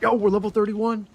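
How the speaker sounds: excited. Real, not acted. Reaching a new level.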